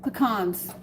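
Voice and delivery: normal talking voice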